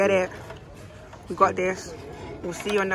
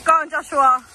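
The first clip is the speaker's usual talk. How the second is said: cheering shout. Real, not acted. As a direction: yell encouragement from afar. Cheering Joshua on the zip line.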